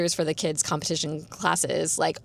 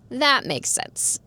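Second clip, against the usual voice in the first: slow, higher-pitched dawning realisation; retold realisation; 7 semitones higher, much slower than usual. Student reacting to news teacher also does ballet, retold realisation. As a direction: draw it out high, as if it all clicks.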